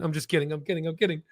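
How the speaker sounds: usual speaking voice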